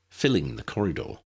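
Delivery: usual speaking voice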